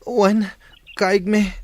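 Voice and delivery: high, loud answer, each word pushed out; shouted reply. Acted. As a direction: call back to the master with effort. Student answering master during training.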